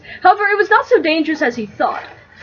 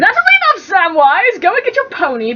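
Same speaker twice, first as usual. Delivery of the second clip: sharp rebuke; 6 semitones higher than usual. Acted. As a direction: cut him off sternly. Frodo scolds Sam.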